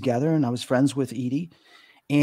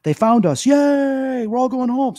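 much higher and louder, a joyful whoop on "Yay"; cheering spirits; 10 semitones higher, 6 dB louder, slower than usual. Retold or imitated. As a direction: voice the spirits cheering as they head home. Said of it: "We got them cheering, going".

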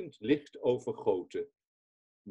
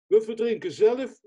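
quoted comrades crying out, higher and louder; mock alarmed cry; 8 semitones higher, 6 dB louder than usual. Comrades fearing they'll drown, higher, louder cry. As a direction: play the frightened comrades calling out in the cold sea. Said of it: "zeiden mijn kameraden".

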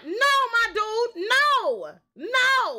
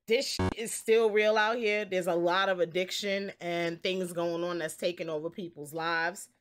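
sincere; 12 semitones lower, 4 dB quieter than usual. Real, not acted. Serious message at end.

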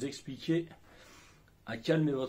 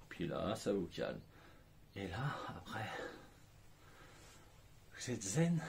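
lower, softer, slow soothing voice; calm and zen; 5 semitones lower, 7 dB quieter than usual. Shown as a demonstration. Coach shows the calm after the anger, soft and soothing. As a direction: settle into calm. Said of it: "qui parle tout doucement".

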